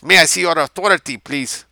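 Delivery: put-on quick, high Mexican-accented request; temporizing Mexican officer. A narrator's reading. Mexican officer asks civilian for authority, Mexican accent. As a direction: ask politely in a Mexican accent, playing for time. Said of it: "the Mexican temporized".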